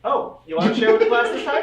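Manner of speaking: loud teasing call-out. Real, not acted. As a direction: tease the player loudly. GM teasing player, loud high.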